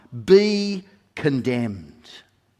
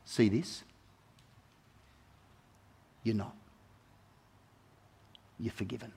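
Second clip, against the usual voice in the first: gentle reassurance; 4 semitones lower, 10 dB quieter than usual. Acted. Jesus gently answers the sinner.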